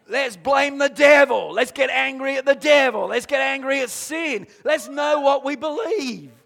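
rally the church against the devil; preaching at full force. Real, not acted.

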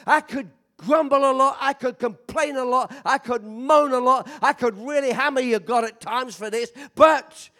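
loud, high, shouting sermon delivery; full-force preaching. Acted. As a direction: preach it out, hammering every word.

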